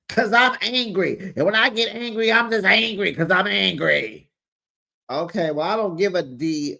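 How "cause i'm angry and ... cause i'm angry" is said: mock angry. Voicing an angry cast member.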